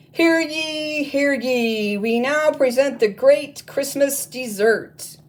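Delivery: high, loud proclamation; herald's cry. Acted. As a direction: announce it like a royal herald. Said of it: "the elves cried".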